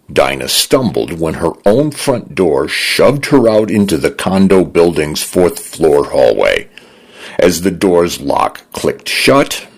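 ordinary delivery

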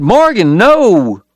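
high, loud plea; Dog crying out to stop Morgan's arrest; pleading cry. A narrator's reading.

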